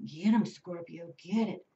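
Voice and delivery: normal talking voice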